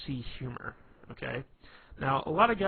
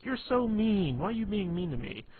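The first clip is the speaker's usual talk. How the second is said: mock whiny girl; 8 semitones higher than usual. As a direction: put on a whiny girlish protest. Teased girl complaining, imitated.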